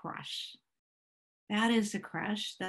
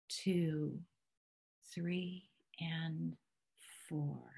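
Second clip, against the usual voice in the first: guided breathing count; 3 semitones lower, 3 dB louder, much slower than usual. Acted. Leader guiding the audience through a four count, slow and measured. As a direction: stretch each number out to pace the breath.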